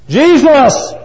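high, loud, drawn-out call; fired-up cry. Acted. Blind man persists toward Jesus, fired-up shout. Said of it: "That just made him get fired up".

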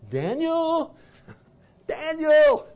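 high, loud wailing call of a name; lamentable cry. Acted. King crying out to Daniel at the lions' den, lamenting. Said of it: "with a lamentable voice".

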